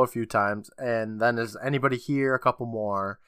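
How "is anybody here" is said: ordinary delivery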